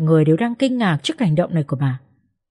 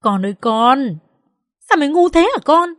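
mock wail at a foolish son, pitch climbing high; hint of a screeching mother; angry screech; 7 semitones higher than usual. A narrator's reading. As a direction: lament with exasperation, rising higher. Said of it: "Bà quay sang Dũng và rít lên".

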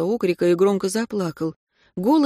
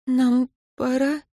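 regretful; 6 semitones higher, slower than usual. A narrator's reading. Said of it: "с сожалением спросила Катя".

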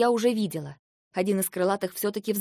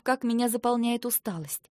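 ordinary delivery, nothing marked

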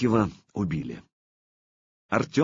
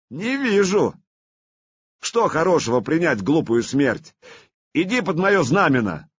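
loud persuasive call; 6 semitones higher, 4 dB louder than usual. Acted. Vladimir woos the commander.

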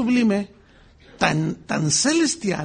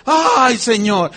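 exclaiming wonder; 4 semitones higher, 7 dB louder, much slower than usual. Retold or imitated. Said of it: "Nosotros siempre".

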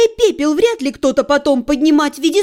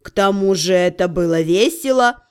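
snooty bored cat; 7 semitones lower than usual. A narrator's reading. Cat demon.

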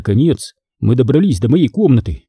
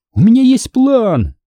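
high, slower triumphant announcement; excited exclamation; 10 semitones higher than usual. A narrator's reading. Hanna taking her friend's arm with a plan, excited. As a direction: announce it with eager relish. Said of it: "вдруг воскликнула Ханна".

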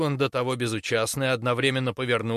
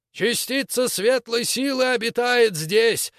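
high, slow, loud zealous shout; 10 semitones higher, 5 dB louder, slower than usual. Acted. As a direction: shout it like a fanatic preacher. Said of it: "закричал Светочей".

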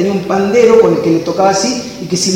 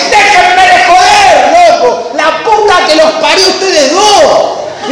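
furious outburst; 9 semitones higher, slower than usual. Retold or imitated. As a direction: explode at the two troublemakers. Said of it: "rompe y dice".

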